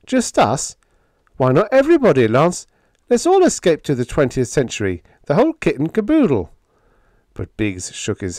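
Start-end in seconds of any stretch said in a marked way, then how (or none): 0.1-6.5 high, loud, urgent protest; sharp cry. Acted. Hank asking Lance to let everybody escape, crying sharply. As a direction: cry out sharply, pitch jumping up. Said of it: "Hank cried sharply".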